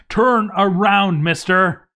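high, loud, slow yell at the screen; panicked shout. Real, not acted. Player yelling at a burning dwarf, panicked shout. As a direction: plead with him to turn back.

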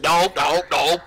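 loud mock outcry; imitated outcry. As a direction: mimic a friend's dismayed shouting. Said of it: "when you said".

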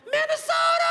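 high, loud state-pride whoop; proud whooping girl. Retold or imitated. Drunk girl to the camera, a high loud whoop. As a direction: cheer your home state at the camera. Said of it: "the girl turns to the camera and goes".

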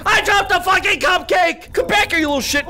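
high, loud scream into frantic apology; panicked shouting. Real, not acted. Player who dropped the cupcake, panicked shouting.

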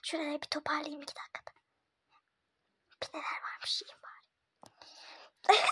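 soft hushed aside; secretive whisper. Real, not acted. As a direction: whisper your plan to the camera. Prankster's secret aside to camera. (kameraya gizlice fısıldayarak)

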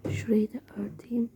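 soft hiding whisper. Real, not acted. Girl whispering while covering herself up. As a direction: keep it hushed under cover.

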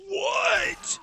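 high incredulous yell; shocked shout. Real, not acted. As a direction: yell it in utter disbelief. Disbelief at dodge lights.

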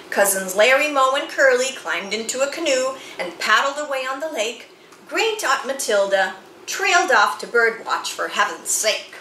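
ordinary delivery

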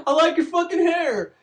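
high teasing voice breaking into laughter; laughing mockery. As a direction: giggle through the taunt like a mean kid. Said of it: "eighth grade class is like".

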